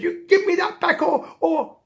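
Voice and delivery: high, loud wailing threat; hammed-up crying cat, falters on the threat; tearful demand. Crying cat demands collar back.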